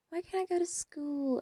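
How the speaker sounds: lightly voiced high, soft complaint; a hint of a plaintive kid sister; imitating little sister.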